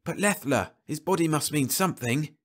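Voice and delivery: a hint of a tense crewman pressing his point; Rice, doubting. Rice arguing with Burnett about the body, lightly voiced.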